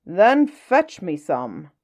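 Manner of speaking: slow, loud, booming whale voice; hungry whale. Acted. Whale demands food from the little fish, slow booming voice. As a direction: rumble it out like a giant hungry whale. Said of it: "said the whale".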